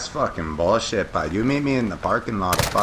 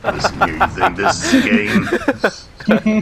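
higher, louder hammed-up menace; mock tough guy; 13 semitones higher, 6 dB louder than usual. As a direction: bark it like a cartoon hard case, joking. Mock tough-guy threat in game.

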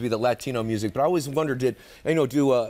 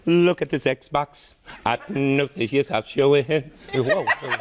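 [name] impression; much slower than usual. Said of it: "[name] walking into a pawn shop".

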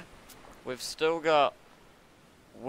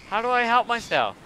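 loud, high plea in alarm; flustered gamer crying out for a fix; mild panic; 10 semitones higher, 8 dB louder than usual. Real, not acted. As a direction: ask it urgently, pitched up. Player sick, about to die.

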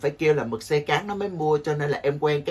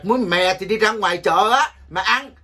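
loud, higher playful retort; mock snap; 4 semitones higher, 5 dB louder than usual. Host brushing off a teasing viewer, louder and higher. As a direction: shoo them off to the market, fake annoyed.

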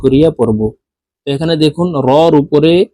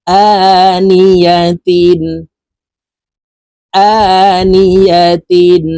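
আনিয়াতিন in a slow, higher chant, first আ held; drawn-out vowel; 5 semitones higher, 5 dB louder, much slower than usual. Shown as a demonstration. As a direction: stretch the opening of আনিয়াতিন. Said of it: "এক আলিফ টেনে পড়ব". (আনিয়াতিন-এর আ টেনে ধীরে পড়া)

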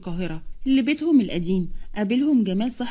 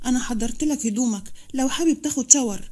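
ordinary delivery, nothing marked

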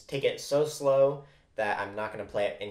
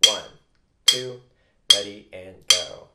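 loud count-off over the drums; count-in call; 9 dB louder, slower than usual. Shown as a demonstration. Drum teacher counting students in, loud count-off. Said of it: "let's do that together".

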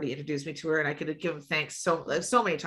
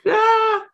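embarrassed yelp; 9 dB louder than usual. Real, not acted. Caught out mid-confession, yelps.

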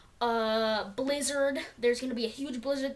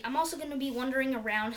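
fast rushed talk; 2 semitones higher, faster than usual. Real, not acted. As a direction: hurry through the words. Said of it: "Sorry, I'm talking fast".

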